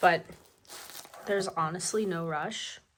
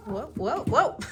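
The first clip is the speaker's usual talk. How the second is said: startled whoa; 5 semitones higher, 6 dB louder than usual. Real, not acted. Reacting to the animal's sudden move.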